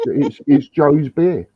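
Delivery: normal talking voice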